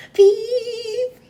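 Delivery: very high, loud whistle peep; engine peep. Acted. Thomas the engine whistling back at the boy, loud high peep. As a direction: squeal it like a train whistle.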